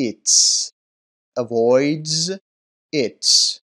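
"eats" with sharp s, "avoids" with buzzing z, each word alone; pronunciation demo. Shown as a demonstration. Teacher contrasting two endings, emphatic "eats" and "avoids". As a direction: say "eats", "avoids", "eats" loudly, one at a time. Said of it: "طریقهٔ تلفظ درستش رو".

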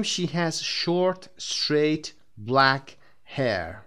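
slow and loud, each adjective spaced out; a teacher modelling adjective order slowly; slow model sentence. Shown as a demonstration. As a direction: spell out the example slowly for the class. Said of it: "long سایز هست".

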